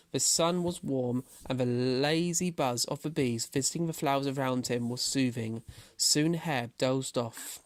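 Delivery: plain reading voice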